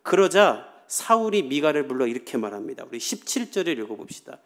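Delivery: normal talking voice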